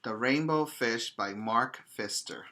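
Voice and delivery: ordinary delivery, nothing marked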